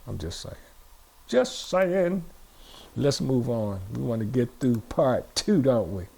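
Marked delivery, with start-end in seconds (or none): 0.1-0.5 low, soft throwaway aside; very soft and low, almost to oneself; quiet aside. Real, not acted. Aside, then said again aloud. 1.3-2.2 higher, full-voiced repeat; pitched up, at normal volume; aside repeated aloud. Real, not acted.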